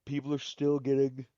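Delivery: talking loudly through a yawn; words stretched by a real yawn. Real, not acted. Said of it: "yawn out of nowhere".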